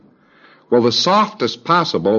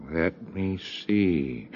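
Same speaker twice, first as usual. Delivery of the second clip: soft and slow; 2 semitones lower, 10 dB quieter, much slower than usual. Acted. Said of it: "You say".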